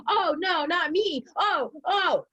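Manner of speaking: high, hammed-up innocent protest; imitating her old self's high mock protest; mock dramatic denial. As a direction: play the drama queen, high. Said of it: "I would be like".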